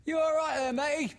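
loud friendly workman's call. A narrator's reading. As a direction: sing it out across the garden. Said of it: "Someone sang out".

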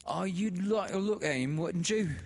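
put-on high, loud, wheedling old woman; sly sister. A narrator's reading. The sister inviting Laura to view the dead man, sly. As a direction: tempt her, sly and eager. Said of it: "said Im's sister".

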